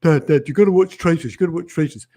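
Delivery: put-on, insistent daughter voice; comic daughter voice. Host mimics daughter urging Dad to watch, comic voice. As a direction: pester Dad in a mock daughter voice. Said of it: "for comedic purposes. That's how she talks".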